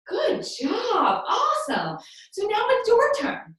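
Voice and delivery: high, upbeat cheering; excited praise. Real, not acted. Teacher praising children before their turn, excited praise.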